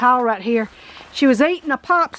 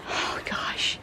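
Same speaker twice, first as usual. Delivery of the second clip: whispered; 13 dB quieter than usual. Real, not acted. Seeing childhood home ruined.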